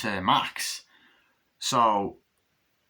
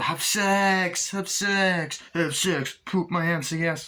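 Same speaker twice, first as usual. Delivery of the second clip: higher, hammed-up moaning lovers; mock sex moans; 8 semitones higher, slower than usual. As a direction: ham up a couple's moans for a laugh. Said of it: "Sorry, I had to do that".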